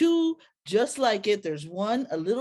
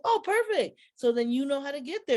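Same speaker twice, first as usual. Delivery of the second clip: excited reply; 6 semitones higher, faster than usual. Retold or imitated. Her own retold reply.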